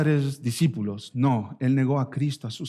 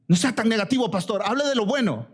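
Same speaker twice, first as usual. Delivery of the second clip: imitating a complainer; 9 semitones higher, faster than usual. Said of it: "Hay gente que me dice".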